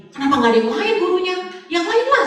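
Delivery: high-pitched mock plea; whiny retold objection; pleading protest. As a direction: beg to have someone else picked, pitch soaring. Acted protest to the one assigning.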